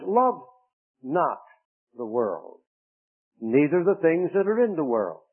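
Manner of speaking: rising, forceful reading of the verse; fervent King James reading; scripture reading. Acted. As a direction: proclaim the verse to the congregation. Preacher reads scripture.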